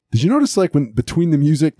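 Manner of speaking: ordinary talk, nothing marked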